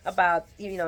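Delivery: ordinary talk, nothing marked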